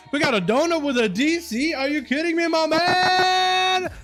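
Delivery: high-pitched, drawn-out excited thanks; hyped donor shout-out. Real, not acted. Streamer greeting a donor, high excited hype. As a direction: stretch the greeting out high, overjoyed.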